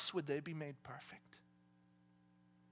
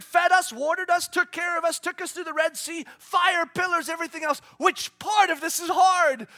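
higher, louder, emphatic arguing; fired-up Caleb; 7 semitones higher, 11 dB louder than usual. Acted. Caleb answering the doubters, fired-up argument. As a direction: argue them into courage with fire. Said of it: "And he goes".

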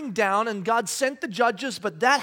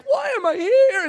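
high, loud cry of parental despair; exasperated; 12 semitones higher, 8 dB louder than usual. Retold or imitated. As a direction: throw it up like a worn-out dad. Parent overwhelmed by kids at home.

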